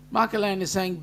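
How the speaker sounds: ordinary talk, nothing marked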